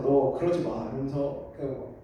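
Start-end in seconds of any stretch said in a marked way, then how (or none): none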